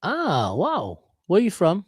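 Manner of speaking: normal talking voice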